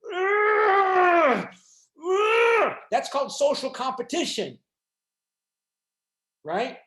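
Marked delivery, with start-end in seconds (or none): 0.1-2.7 high, loud disgusted groan; frustrated groan shout. Retold or imitated. Lecturer playing a resentful German reacting to Britain, loud disgusted groan. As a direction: let out a fed-up, envious groan. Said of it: "you might go".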